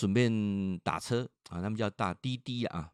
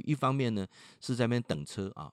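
usual speaking voice

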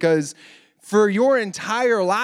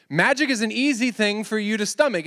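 usual speaking voice

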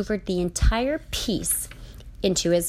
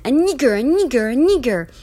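loud, high, insistent repeated shout; frustrated yelling, over and over; yelling repeatedly; 7 semitones higher, 8 dB louder than usual. A narrator's reading. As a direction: hammer the word out in a chant-like yell. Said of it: "he yelled the word over and over".

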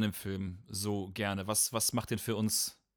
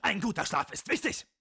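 very high, barked drill-sergeant orders; stiff barking toy soldier; 7 semitones higher than usual. Acted. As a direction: bark it like a tiny wind-up sergeant. Comic drill skit, sergeant. (hoher, zackiger Spielzeugsoldaten-Befehlston)

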